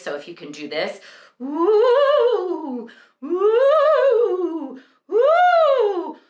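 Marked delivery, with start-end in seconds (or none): 1.4-6.2 high ghostly "woo" hoots; loud swooping hoots in head voice; hooty owl voice. Shown as a demonstration. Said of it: "the ghost or the hooty owl voice".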